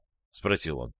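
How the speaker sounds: ordinary delivery, nothing marked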